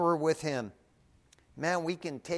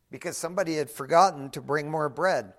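usual speaking voice

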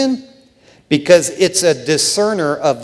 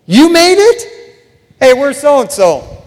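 loud, high-pitched surprised greeting; excited greeting; 8 semitones higher, 8 dB louder than usual. Acted. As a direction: call out like greeting a long-lost friend. Acted excited greeting at heavenly table.

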